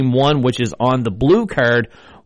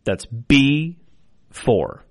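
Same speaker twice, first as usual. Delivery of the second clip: slow, drawn-out number calling; bingo caller; 2 semitones higher, slower than usual. Acted. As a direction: call each number clearly, then repeat. I will be your bingo caller.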